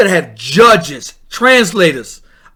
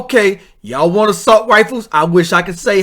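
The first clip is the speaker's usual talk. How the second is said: ordinary talk, nothing marked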